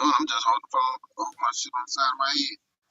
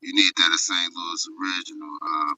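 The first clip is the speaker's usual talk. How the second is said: muffled; 9 semitones lower than usual. Real, not acted. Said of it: "you sound muffled".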